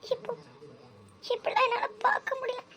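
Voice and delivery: high, quiet crying voice; high-pitched, tearful lament; tearful despair. Acted. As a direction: sob through the words in despair. Tearful despair over the engagement.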